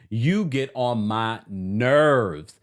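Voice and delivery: "you get on my nerves" stretched out very slowly; drawn-out exasperation, stress on "you"; slow exasperated drawl. Real, not acted. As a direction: drag every word out in exasperation. Scolds the character, then repeats.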